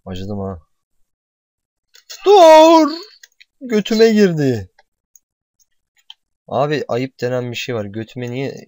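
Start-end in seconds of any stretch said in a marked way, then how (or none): 2.2-4.7 high, very loud yell, falling off slowly; comic pained scream. Pretend. Player as the impaled game character, high comic scream.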